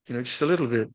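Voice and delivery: ordinary talk, nothing marked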